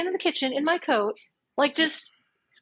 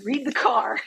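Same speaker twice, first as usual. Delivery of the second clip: whisper. Real, not acted. Aside mocking her own words.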